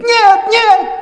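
very high, loud cry of refusal; mother shrieks in desperation; screamed panicked no; frantic no. Acted.

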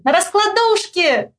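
high, drawn-out reply; high slow call; shouting back. A narrator's reading. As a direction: holler the answer in passing. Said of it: "Я кричу".

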